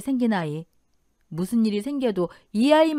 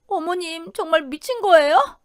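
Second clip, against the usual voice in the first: high-pitched, shocked protest; aghast daughter-in-law, voice raised in pitch; appalled disbelief; 7 semitones higher than usual. Acted. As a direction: challenge her in disbelief. Daughter-in-law reacts to the abuse.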